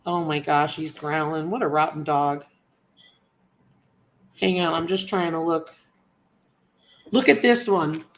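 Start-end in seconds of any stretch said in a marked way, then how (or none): none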